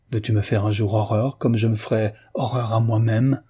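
plain reading voice

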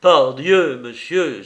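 loud burst of pique on the first word; vexed exclamation. A narrator's reading. Tristan to the intruding rival, loud vexed outburst. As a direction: exclaim it, unable to contain annoyance. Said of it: "ne pouvant contenir un mouvement de dépit".

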